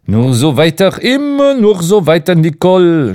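lightly teasing, mock sing-song. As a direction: poke fun at him a little. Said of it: "spottete Ardant".

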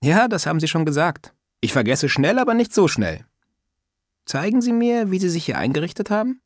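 put-on a prying old lady scolding lightly; old woman's voice. Old neighbour teasing Sascha about manners, lightly voiced old woman.

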